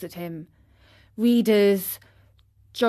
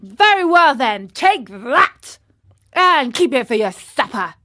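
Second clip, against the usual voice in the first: loud, higher, aggressive shout; calling out; 3 semitones higher, 7 dB louder than usual. A narrator's reading. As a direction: call it out as you land a blow. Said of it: "called out the boldest".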